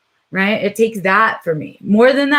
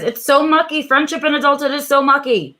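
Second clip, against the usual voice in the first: a fond, emphatic complaint about the word mucky; relishing mucky; 7 semitones higher than usual. Real, not acted. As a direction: lean into the word mucky, pitched up. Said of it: "I like the word mucky 'cause that's exactly how it feels".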